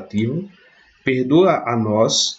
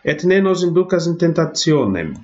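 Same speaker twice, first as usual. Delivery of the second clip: intoned Latin prayer; 7 semitones higher than usual. Shown as a demonstration. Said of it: "teacher reads Latin prayer line".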